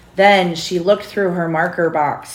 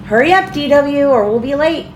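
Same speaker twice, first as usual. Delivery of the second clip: put-on high, raised call to a child upstairs; mother calling through the house; 8 semitones higher, 6 dB louder than usual. A narrator's reading. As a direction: hurry them along, calling loudly. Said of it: "called Mother".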